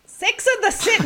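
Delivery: high-pitched Scottish attempt drifting into Indian; failed Scottish accent. Shown as a demonstration. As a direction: try a Scottish accent and miss it badly. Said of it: "That went a bit Indian".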